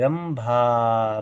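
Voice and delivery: Rambha very slowly, भा held long; long final vowel. Shown as a demonstration. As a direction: stretch the aa at the end of Rambha. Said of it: "भा में बड़े आ की मात्रा".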